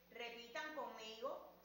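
normal talking voice

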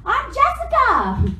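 high, drawn-out mock-eager girl; perky introduction. Retelling a girl introducing herself brightly.

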